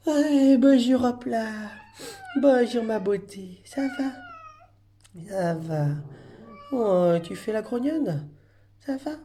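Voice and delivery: high, slow sing-song pet talk. Real, not acted. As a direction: owner sweet-talking a grumpy cat. Greeting a grumpy cat sweetly.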